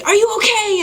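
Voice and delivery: high, frantic shout of a stranger; panicked yell. Retold or imitated. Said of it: "he starts screaming at me".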